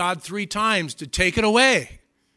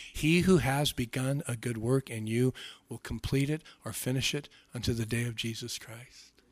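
normal talking voice

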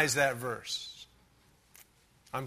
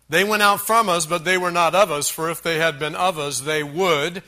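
loud, forceful Bible reading; emphatic scripture reading; 6 dB louder than usual. Acted. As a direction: proclaim the verse from the pulpit. Reads the verse aloud.

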